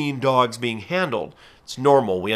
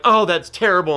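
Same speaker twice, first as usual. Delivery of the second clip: mock upset onlooker; 6 semitones higher, 4 dB louder than usual. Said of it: "these people are like".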